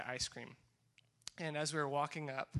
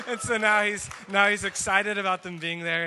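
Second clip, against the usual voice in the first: talking through real laughter, louder and higher; laughing; 6 semitones higher, 14 dB louder than usual. Real, not acted. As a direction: let the laugh carry the words.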